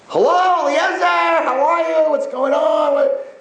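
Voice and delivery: high-pitched, exuberant hello; imitating an overjoyed mother on the phone; effusive phone greeting. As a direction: greet your son in a high, delighted shout.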